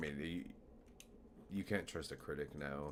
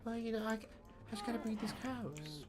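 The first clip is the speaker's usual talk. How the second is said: [name] impression. Streamer impersonating Michael while breeding cows. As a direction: do your best [name]. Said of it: "Michael impersonation voice".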